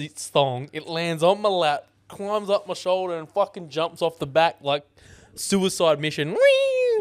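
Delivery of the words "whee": high, loud, drawn-out whee; mimicked whee. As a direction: squeal a gleeful flying whee. Acting out the bandicoot's leap.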